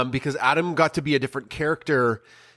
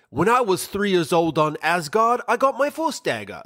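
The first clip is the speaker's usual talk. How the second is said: put-on higher-pitched, half-hearted Loki accent; badly faked Loki accent, pitched up; attempted Loki accent; 5 semitones higher than usual. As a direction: imitate Loki's accent, not quite managing it. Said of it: "I can't do the Loki accent".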